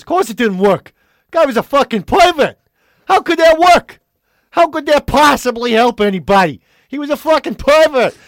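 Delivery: put-on loud, high, outraged New York accent; intense New Yorker. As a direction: explode in mock disbelief as an intense New Yorker. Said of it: "he's very intense".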